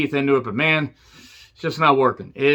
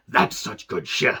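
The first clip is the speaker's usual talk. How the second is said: low, unvoiced gloating whisper; Vince McMahon whisper; 5 semitones lower, 5 dB quieter, slower than usual. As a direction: hiss it like a scheming boss. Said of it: "laughing like a supervillain".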